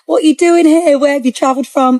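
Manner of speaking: friendly curious stranger. Retold or imitated. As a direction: mimic a stranger striking up conversation. Quoting friendly chatty strangers.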